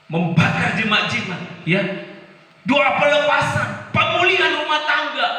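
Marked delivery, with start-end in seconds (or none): 2.7-5.3 high, loud preaching cry; fervent pulpit preaching; preaching at full force. Acted. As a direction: proclaim it to the congregation. Preacher raises voice.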